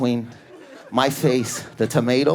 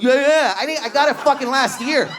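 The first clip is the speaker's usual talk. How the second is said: flustered customer; 9 semitones higher than usual. Retold or imitated. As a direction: babble back in high-pitched panic. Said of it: "You're like".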